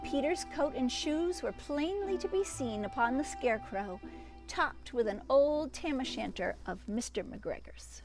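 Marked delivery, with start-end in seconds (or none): none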